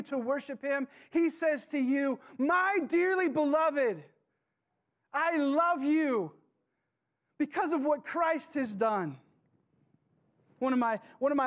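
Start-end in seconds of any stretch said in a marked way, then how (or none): 2.4-9.2 slow, tender and loving; tender voice of God. Acted. Preacher voicing God, tender and slow to the church. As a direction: speak it as God reassuring his child.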